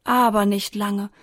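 ordinary delivery

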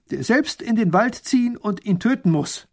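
high, loud, emphatic dictation; proclaiming decree. A narrator's reading. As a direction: pronounce the sentence with force. Ruler dictating the law's end.

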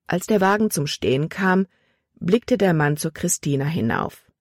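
ordinary delivery

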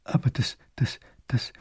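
shocked stammering whisper. Acted. Pale grand duke stammering at the captain, whispered. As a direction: stutter it out in a whisper. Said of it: "kann der bleich gewordene Großherzog nur stottern".

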